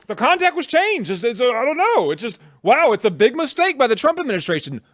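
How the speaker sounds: high-pitched feigned surprise, hammed-up; mock-innocent journalist. Host mocking an opposition journalist's lucky strike, mock-innocent. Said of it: "where he's like".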